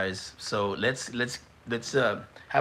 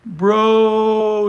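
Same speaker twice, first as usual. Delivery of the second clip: loud, much higher shout of disbelief; stunned shout; 8 semitones higher, 10 dB louder than usual. Real, not acted. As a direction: yell it like you can't believe it. Reacting to savage chat message.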